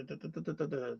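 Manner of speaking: normal talking voice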